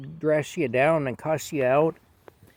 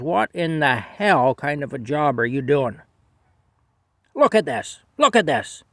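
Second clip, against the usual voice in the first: loud telling-off, jumping higher at the end; angry scolding; 3 semitones higher, 7 dB louder than usual. Retold or imitated. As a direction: imitate a boss chewing someone out, loud. Acted supervisor scolding a worker.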